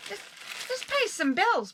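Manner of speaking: ordinary talk, nothing marked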